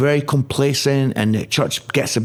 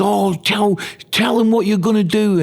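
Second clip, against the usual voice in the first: higher, amused put-down, imitating the pastor; mocking laugh; 10 semitones higher than usual. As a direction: mimic a leader mocking the idea. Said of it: "He just laughed".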